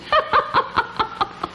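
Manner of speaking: high breathless cackle; voiceless taunting laugh; mocking cackle. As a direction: cackle at him. Mocking him about his face.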